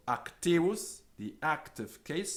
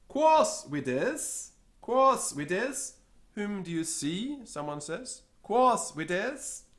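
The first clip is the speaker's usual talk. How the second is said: high, louder question; high asking voice; 8 semitones higher, 5 dB louder, slower than usual. Acted. Someone in the crowd asking the cameraman, high and loud. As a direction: call it out curiously.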